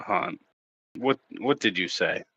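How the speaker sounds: ordinary delivery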